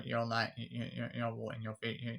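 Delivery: usual speaking voice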